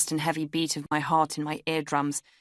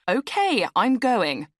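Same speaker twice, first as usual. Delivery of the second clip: high, louder huffy exit line; exasperated; 7 semitones higher, 5 dB louder, slower than usual. A narrator's reading. Woman walking out on the man, exasperated. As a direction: announce it loudly, pitch swooping down.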